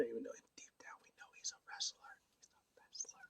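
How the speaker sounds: whispered aside; conspiratorial whisper. Pretend. Confiding that Garcia is really a wrestler, stage whisper. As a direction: drop to a secretive, very soft whisper.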